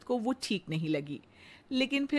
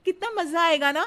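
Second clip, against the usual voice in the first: much higher, louder, thrilled; delighted; 9 semitones higher, 6 dB louder than usual. Acted. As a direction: squeal at the good news. Radha delighted by Bombay.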